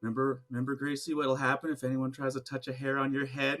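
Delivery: quietly voiced reassuring mom; calming mother. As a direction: gently settle a daughter down. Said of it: "tries to calm her daughter down".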